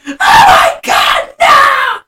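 very high, loud, slow horrified shout; mock freak-out scream. Molly seeing the mannequin, mock screaming in horror. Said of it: "She freaks out".

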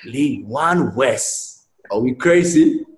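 drawn-out, scornful disbelief; slow incredulous rant rising at the end; ranting manager. Retold or imitated. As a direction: imitate an exasperated manager, stretching each word. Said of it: "I can see him going mental".

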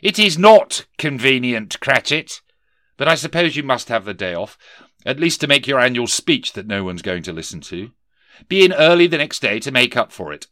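stern miser boss. Acted. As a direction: bark it down at the clerk. Scrooge grudging to clerk.

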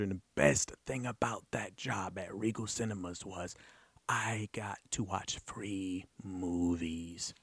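whisper. Real, not acted. Host confiding the best perk of his theater job in a whisper.

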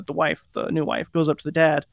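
ordinary talk, nothing marked

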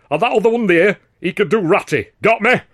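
high, loud, coarse mockery in broad Lancashire; blustering northern bully. A narrator's reading. Star comic overrules the manager, loud and mocking. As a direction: bark him down and sneer at the newcomer. Weldon barking orders.

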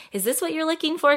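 high, quick, tentative question; quick high-pitched imagined query; universe asking. Acted.

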